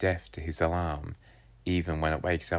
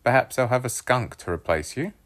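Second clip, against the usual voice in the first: quip; 7 semitones higher than usual. A narrator's reading. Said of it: "I quipped".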